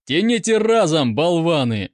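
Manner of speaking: loud, high officer's bark; exasperated shouted command. A narrator's reading. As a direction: bellow an impatient order. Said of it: "рявкнул поручик".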